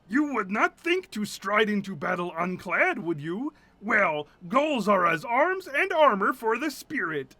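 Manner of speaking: grand, stately knightly voice; lofty old-fashioned knight; Dolores's voice. Acted. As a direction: declaim it like a noble warrior giving advice. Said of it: "I don't know why Dolores has that voice".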